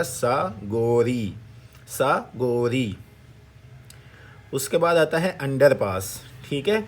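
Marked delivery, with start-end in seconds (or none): 0.0-2.9 very slow, stretched model pronunciation; syllable-by-syllable demo. Shown as a demonstration. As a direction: stretch سا, then Gauri, for learners. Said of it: "تو کیا بنے گا". (ہر جز کھینچ کر آہستہ بولیں)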